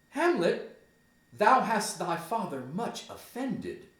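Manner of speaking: high-pitched voice of a reproachful mother. Acted. Gertrude rebukes Hamlet for offending his father, high and chiding. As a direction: pitch it up, chiding your son. Gertrude acted scolding her son.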